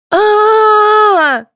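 very high, drawn-out shriek; childish squeal. A narrator's reading. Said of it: "завизжала Светлана".

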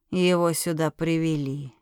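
usual speaking voice

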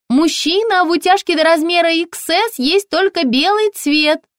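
very high, chirpy shop assistant; high-pitched, ringing sales voice; ringing saleswoman voice. A narrator's reading. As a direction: pipe up brightly through the door. Ringing-voiced saleswoman lists colours.

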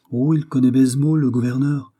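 knowing, crafty lilt; sly. Acted. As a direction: drop the hint slyly, lifting the pitch. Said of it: "répliqua le mousquetaire d'un ton sournois".

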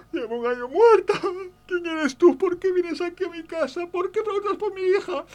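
put-on very high, wailing old woman voice; crying old lady. Grieving old mother shouting at the stranger at her door, crying high. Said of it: "Y ella lloraba".

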